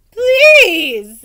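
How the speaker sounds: loud, high urging knight. Acted. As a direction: plead loudly. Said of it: "the old knight urged".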